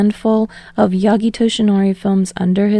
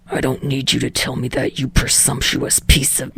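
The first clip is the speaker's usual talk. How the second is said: lower, softer growling Bakugo voice; snarled; 7 semitones lower, 3 dB quieter than usual. Acted. Bakugo answering Deku's call, low snarl.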